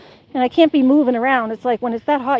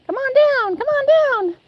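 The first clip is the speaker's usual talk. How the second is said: calling the dog; 13 semitones higher, faster than usual. Real, not acted.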